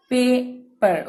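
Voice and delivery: "पेपर" split after the long पे; syllable break. Shown as a demonstration. Teacher gives an example of long A, "पेपर" syllable by syllable. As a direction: say "पेपर" in two separate parts. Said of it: "because your syllable breaks".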